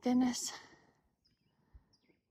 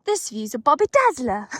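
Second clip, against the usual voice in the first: put-on a playful stab at a British accent, louder; attempted British accent; 6 dB louder than usual. Hiker shows off British slang to partner, attempted British accent. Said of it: "Not, not in that accent".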